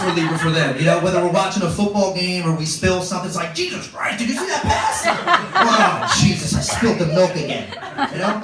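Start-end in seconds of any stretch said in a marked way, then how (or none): 3.5-5.0 outraged sports-fan yell. Acted. Dad watching a football game, outraged yell. 5.5-7.7 loud exclamation that drops in pitch; exasperated outburst. Acted. Parent who spilled the milk again, exasperated outburst.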